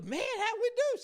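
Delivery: high, loud, hyped-up asking; eager question. Retold or imitated. Man checks on his profits, loud and eager. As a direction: ask in mock excitement, high and loud.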